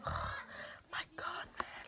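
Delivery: unvoiced, hushed oh my God; whispered disbelief. Two girls at school gate, whispering in shock. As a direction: breathe it out in a stunned hush.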